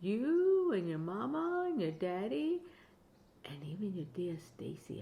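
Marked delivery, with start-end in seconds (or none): 3.5-5.0 soft teasing whisper. Acted. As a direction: drop to a secret whisper. Playful whisper to grandchild.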